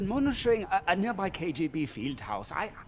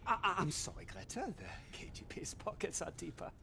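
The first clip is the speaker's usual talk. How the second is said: guilty apology; 12 semitones higher, 9 dB quieter, faster than usual. Acted. Exposed betrayer apologises to Greta.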